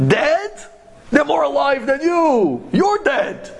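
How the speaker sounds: high, indignant comeback; indignant retort. Retold or imitated. Scholar defends the tzaddikim to the driver, indignant. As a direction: retort with pitch thrown right up.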